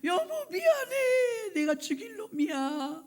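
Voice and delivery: high, slow wailing confession, hammed up; melodramatic weeping apology. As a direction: imitate a repentant husband bawling to his wife. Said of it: "심파극을 찍어요". (신파조로 통곡하며 비는 흉내)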